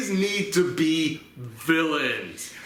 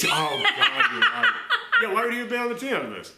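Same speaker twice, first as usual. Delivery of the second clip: high, breathy laughing reply; cracking up at the point; 9 semitones higher than usual. Real, not acted. Conceding to a friend while laughing hard.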